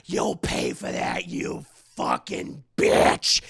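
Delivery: very low, slow, rasping threat; menacing doll. Retold or imitated. Thrown doll vows revenge on the mother, low slow growl.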